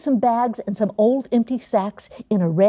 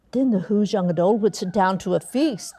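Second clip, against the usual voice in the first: ordinary delivery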